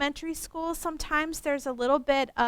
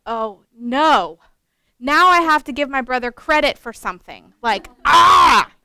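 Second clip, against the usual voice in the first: mock dismay; 10 dB louder than usual. Dismay at crediting her brother.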